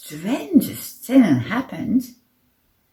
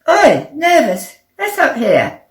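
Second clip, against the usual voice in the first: loud, higher summons; calling out; 9 semitones higher, 9 dB louder than usual. A narrator's reading. Captain calling Nervous up, loud and bossy. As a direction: order a hidden underling up.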